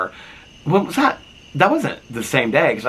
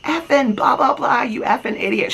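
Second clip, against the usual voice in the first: imitated screaming at husband. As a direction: imitate a wife ranting shrilly into the phone. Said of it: "She's, like, screaming at her husband".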